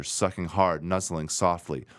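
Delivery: usual speaking voice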